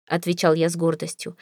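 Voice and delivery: usual speaking voice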